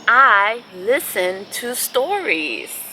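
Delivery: slow, gentle storybook reading; picture-book read-aloud with long pauses; reading to children. Acted.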